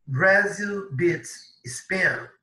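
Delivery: Brazil beats Spain read very slowly; slow reading aloud. Shown as a demonstration. Teacher reading an English headline slowly to the class. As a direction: spell out the headline for learners. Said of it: "rereads headline slowly".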